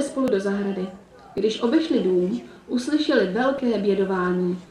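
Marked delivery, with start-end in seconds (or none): none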